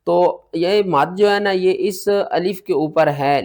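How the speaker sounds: normal talking voice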